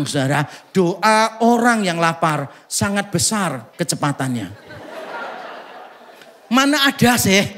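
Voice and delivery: ordinary delivery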